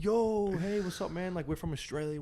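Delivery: mock upbeat pitch to a driver; pumped-up greeting. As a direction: sell yourself like an over-eager friendly stranger. Acting out outgoing hitchhiker pitch.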